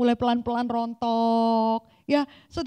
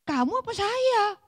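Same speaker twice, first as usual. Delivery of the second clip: higher, slow pointed question as God; God asking back; 7 semitones higher, slower than usual. Acted. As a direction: turn the question back calmly but firmly. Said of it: "Tuhan akan nanya". (Tuhan bertanya balik, pelan dan tegas)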